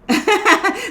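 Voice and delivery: sudden laugh. Real, not acted. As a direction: burst of high real laughter. Laughs mid-sentence.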